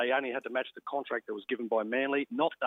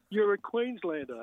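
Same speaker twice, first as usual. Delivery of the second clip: higher and slower, savouring the scorn; drawn-out mocking laugh; 7 semitones higher, slower than usual. Packer laughing off the rookie's huge demand, slow and high. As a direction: stretch it out, chuckling at him. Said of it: "And he just kept laughing".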